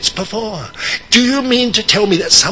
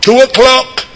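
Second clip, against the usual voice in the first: loud, high, pleading cry to the Lord; desperate prayer; 10 semitones higher, 6 dB louder than usual. Retold or imitated. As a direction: beg God out loud for the money right now. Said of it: "he was saying".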